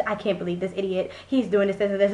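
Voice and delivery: imitating an aunt grumbling to herself, low and soft; quiet, passive-aggressive griping; muttered self-talk. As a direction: mutter annoyance under your breath. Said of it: "she'll talk to herself and, like, be pissed off".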